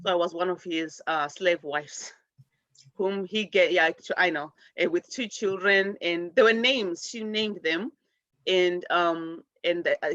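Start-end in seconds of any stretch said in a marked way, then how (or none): none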